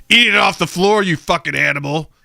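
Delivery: hammed-up rage at a picky kid; mock furious dad. Dad fantasizing about snapping at his daughter. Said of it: "and be like".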